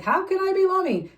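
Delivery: hammed-up high frantic question; frantic self-parody. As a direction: overplay a flustered believer. Mocking her own frantic self.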